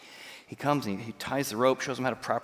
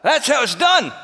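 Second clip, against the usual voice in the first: much higher and louder boast, called up from below; triumphant shout; 11 semitones higher, 12 dB louder than usual. Retold or imitated. Instructor calls up to the students after his jump, triumphant shout. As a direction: shout it up the hill, proud of the landing.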